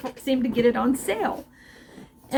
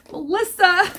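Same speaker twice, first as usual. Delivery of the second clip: overwhelmed laugh; 9 semitones higher, 5 dB louder than usual. Real, not acted. Overcome by a gift.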